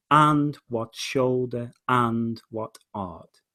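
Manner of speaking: slow verse with weight on "what"; measured reading, "what" drawn out and stressed; stressed key words. Shown as a demonstration. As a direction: lean slowly on "what" each time. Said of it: "stressing certain words".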